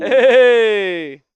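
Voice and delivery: a sharp shout of greeting; a loud, happy call back; loud hey. Real, not acted. Answering the hype back.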